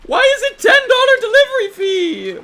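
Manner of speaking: high, loud whining wail; anguished wail. Real, not acted. Streamer to chat, wailing in mock despair over the fee. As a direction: wail it like it's a tragedy.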